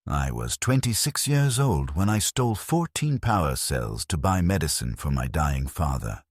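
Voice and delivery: usual speaking voice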